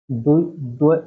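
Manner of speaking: ordinary talk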